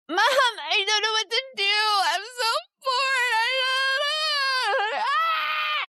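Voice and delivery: put-on very high, loud wailing kid meltdown; screaming, crying kid. Shown as a demonstration. Kid who cannot handle boredom cries to mom, screaming. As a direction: wail and shriek like a dysregulated child, stretching the words out. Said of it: "they're screaming and they're yelling and they're crying".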